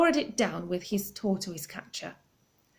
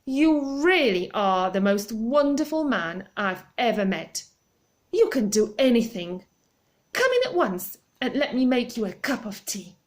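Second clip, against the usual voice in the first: louder, gushing praise; crying out with delight; 2 semitones higher, 5 dB louder than usual. Acted. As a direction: cry it out with delight. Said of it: "cried out".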